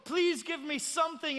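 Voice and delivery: high, pleading call; crying out. Acted. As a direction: plead with the gods of this world. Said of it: "crying out to the gods".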